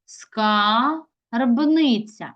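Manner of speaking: "Скарбниця" very slowly as Ска, pause, рбниця; teacher splitting "Скарбниця" into Ска... рбниця; divided for hyphenation. Shown as a demonstration. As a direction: break "Скарбниця" right after a stretched Ска. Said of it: "Читаємо".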